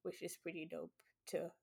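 barely whispered, choked up; whispering. Real, not acted. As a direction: voice dropping to a whisper. Choked up while praising friend.

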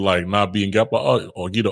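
ordinary talk

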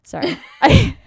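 high laughing apology. Real, not acted. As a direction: crack up mid-word. Bursting out laughing.

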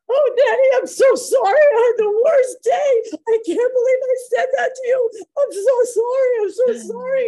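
very high, loud mock-sobbing daughter; tearful apology. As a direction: wail the apology like a crying teenage girl. We hear laughing and crying.

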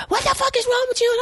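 high, quick mock yell. Guy yelling from the car at her, high mock yell. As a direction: bark it like an annoyed guy in a car. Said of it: "He is yelling out the car".

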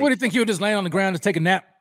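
fast, loud, high-pitched challenge; heated disbelief. Real, not acted. Co-host challenging the other about an injured player, heated disbelief. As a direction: throw it back at him, incredulous and quick.

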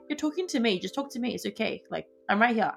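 ordinary talk, nothing marked

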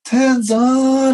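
high, loud drawn-out name call; announcer calling name. Retold or imitated. Ring announcer calling out Tenzan, high loud call. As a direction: boom the name to the arena. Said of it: "And he s-, goes".

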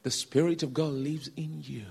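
low, softened, measured voice; gentle reassurance. Retold or imitated. Preacher telling the young man about the Spirit, gently. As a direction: teach it softly and tenderly.

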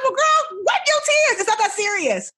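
very high, loud playful reassurance; teasing comfort. Retold or imitated. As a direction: cheer up a crying intern. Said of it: "I was like".

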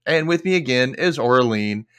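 usual speaking voice

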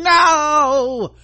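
high, long, loud wailing "No"; hammed-up anguished scream; mock scream. Host imagines losing a hand like Luke Skywalker, hammed-up scream. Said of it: "I would have been screaming".

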